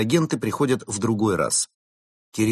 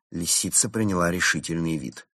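ordinary delivery, nothing marked

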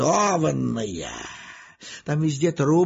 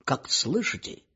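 crackly military radio; 8 semitones lower, 5 dB quieter, slower than usual. Retold or imitated. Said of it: "людские голоса заговорили".

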